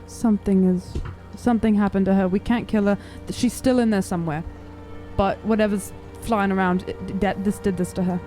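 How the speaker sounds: normal talking voice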